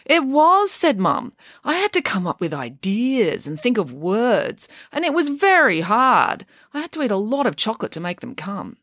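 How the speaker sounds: a mum milking her hard day for sympathy; hammed-up complaining mum. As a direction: play up the drama of a tough day. Mum's dramatic complaining voice.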